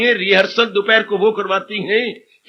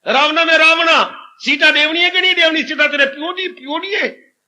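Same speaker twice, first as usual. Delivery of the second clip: Ramlila stage call; 6 semitones higher than usual. Retold or imitated. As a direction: bellow it like a village Ramlila actor on stage. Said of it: "Ramlila".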